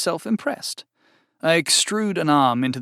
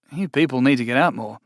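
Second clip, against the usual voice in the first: plain reading voice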